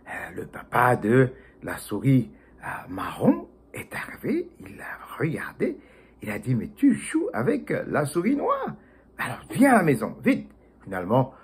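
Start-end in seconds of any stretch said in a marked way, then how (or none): 2.9-5.8 whispered narration, breath only. A narrator's reading. 6.6-10.5 stern father. Acted. Father scolds his son.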